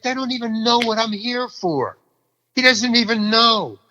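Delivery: high-pitched incredulous complaint; indignant disbelief. Retold or imitated. Actor realizes director doesn't know his role, indignant. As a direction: voice the outraged thought, pitched high. Indignant thought retold at audition.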